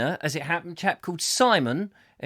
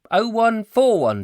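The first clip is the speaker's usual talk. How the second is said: slow, higher, louder digit by digit; drawn-out digits, raised voice; dictating slowly; 6 semitones higher, 5 dB louder than usual. Acted. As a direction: repeat the code while writing it down. Dictating a code while writing it.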